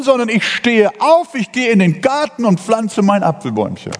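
high-pitched, emphatic resolve; resolute declaration. Acted. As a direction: declare it with rousing determination. Acting the resolute believer's answer.

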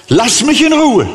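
God shouting Moses off, high and loud; God's loud command. Acted. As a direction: push Moses away with it. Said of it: "Und Gott sagt".

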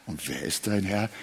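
ordinary talk

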